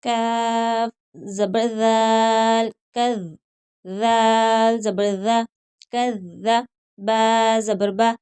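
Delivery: slow, drawn-out letter-by-letter recitation; slow tajweed drill. Shown as a demonstration. Teacher drilling students letter by letter, slow recitation. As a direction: spell and join each syllable like a Quran teacher.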